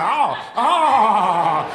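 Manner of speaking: rising mock moans. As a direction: moan it out, hammed-up. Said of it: "She was like".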